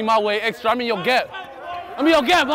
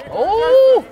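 excited; 7 dB louder than usual. Real, not acted. Relief after a drink.

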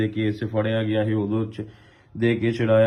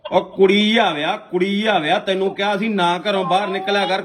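high-pitched, upset reproach, easing slightly towards the end; real anguished telling-off; distressed scolding; 5 semitones higher than usual. Real, not acted. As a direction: scold your son in raised, pleading pitch. Scolds arrested son over the phone.

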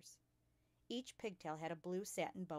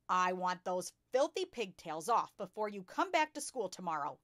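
loud, forceful barked order; 8 dB louder than usual. A narrator's reading. Headmistress orders a pupil to lose her pigtails, barking. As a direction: bark it at the child. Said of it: "she barked".

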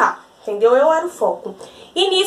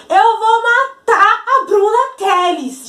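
slow, higher, louder imitated shout of the bully; mock a bully screaming a death threat down the stairs; drawn-out, hammed-up yell; retold yelled threat; 7 semitones higher, 4 dB louder, much slower than usual. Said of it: "ela gritou pra escola toda".

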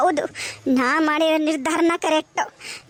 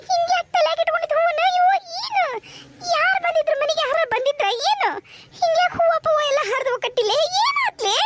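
very high, distraught voice; frantic questioning; 10 semitones higher than usual. Acted. Mother asking her daughter who came and why flowers are torn, frantic. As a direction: fire anxious questions, pitched way up.

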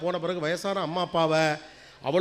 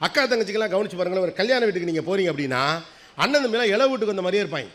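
ordinary talk

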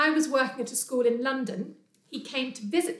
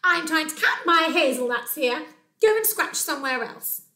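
loud, high, exasperated scolding; yelling squirrel; 5 semitones higher, 7 dB louder than usual. Acted. Squirrel counting hazelnuts scolds Bear, cross yell. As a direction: tell off the intruder emphatically. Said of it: "yelled a squirrel".

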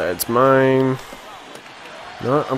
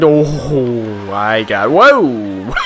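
loud whoops rising in pitch; astonished excitement; 2 semitones higher, 7 dB louder, much slower than usual. Real, not acted. Gamer watching the shot go in, astonished shout. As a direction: build to a yelled whoa. Goal being scored.

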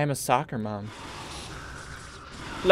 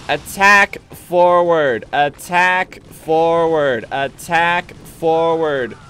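slow drawn-out command; 2 semitones higher, 3 dB louder, much slower than usual. Pretend.

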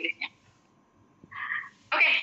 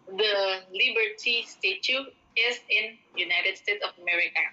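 unhurried sample sentence; slow example. Shown as a demonstration. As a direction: read slowly and clearly. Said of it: "slow example sentence". (dibaca pelan sebagai contoh)

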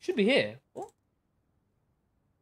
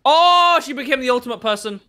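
high, loud oh fading into a raised complaint; loud exclaim; 8 semitones higher, 12 dB louder than usual. Real, not acted. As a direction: shout in disbelief at the enemy. Reacting to the enemy with the pipe.

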